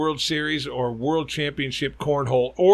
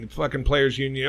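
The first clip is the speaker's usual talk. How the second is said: ordinary talk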